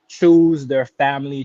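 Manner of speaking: normal talking voice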